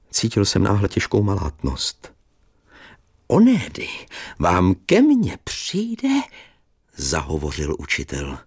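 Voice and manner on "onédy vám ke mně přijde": high, slow kindly schoolmaster; cheerful old teacher. Acted. Old teacher visits his pupil, high and slow. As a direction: chatter fondly like a relieved old teacher.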